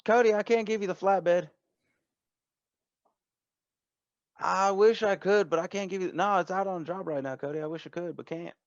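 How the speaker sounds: high folksy voice turning down a favor; high-pitched character voice, reluctantly refusing; apologetic shop owner. Acted. Game master plays Denise refusing.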